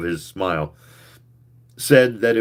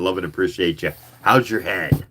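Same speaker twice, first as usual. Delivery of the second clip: ordinary delivery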